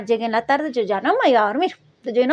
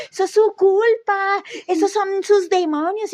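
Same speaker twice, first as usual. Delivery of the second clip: high, slow voice of a reproachful mom; scolding mother; 7 semitones higher, slower than usual. Retold or imitated. A mother tells her daughter the demons are her own fault, slow and reproachful. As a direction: lay the blame on her, slowly.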